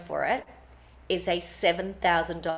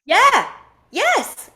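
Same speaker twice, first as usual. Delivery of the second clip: much higher, louder eager yes; excited yes; 13 semitones higher, 8 dB louder than usual. Retold or imitated. Remembering the book idea, thrilled high shout of yes. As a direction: leap on the idea with delight.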